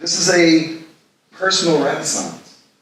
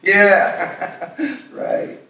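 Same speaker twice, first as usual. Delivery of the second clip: laughing it off; 8 semitones higher than usual. Acted. Laughing off friend's joke.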